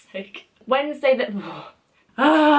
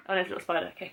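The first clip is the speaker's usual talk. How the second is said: usual speaking voice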